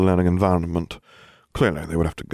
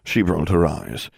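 plain reading voice

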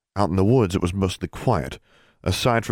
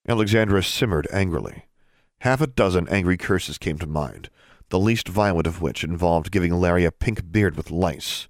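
ordinary delivery

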